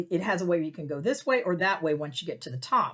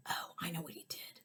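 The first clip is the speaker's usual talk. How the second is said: soft unvoiced aside, I know it; whispered realization; 15 dB quieter than usual. Realizing the scam, whispering to self. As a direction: breathe it like a secret.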